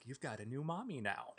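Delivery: mock menace, half laughing; mock kidnapper telling his daughter quietly; creepy quiet line. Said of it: "and you're like".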